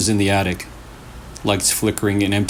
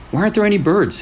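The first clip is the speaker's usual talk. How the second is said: worried question; 9 semitones higher, much faster than usual. A narrator's reading. Hannah worried in quiet forest.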